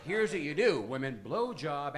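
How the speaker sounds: normal talking voice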